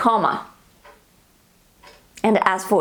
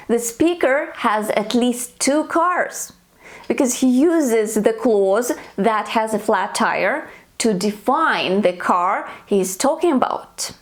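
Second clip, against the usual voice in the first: usual speaking voice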